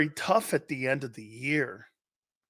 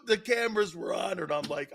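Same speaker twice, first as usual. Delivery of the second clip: normal talking voice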